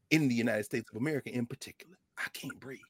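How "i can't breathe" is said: barely audible, much softer hush; hushed repeat. Acted. Repeating the dying words to the room, a hushed near-whisper. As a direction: let the words die away to almost nothing.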